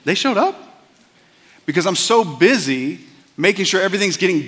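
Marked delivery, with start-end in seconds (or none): 0.0-0.5 rising, disbelieving double-take; startled disbelief. Retold or imitated. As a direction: suddenly realize you missed your friends. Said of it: "And I have to think".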